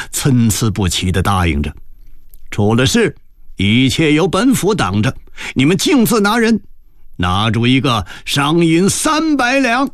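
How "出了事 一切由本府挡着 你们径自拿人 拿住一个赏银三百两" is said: high-pitched official command; prefect urging his men. A narrator's reading. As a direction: spur the guards on, pitch climbing. Prefect goading guards to seize people. (府尊高声催促手下)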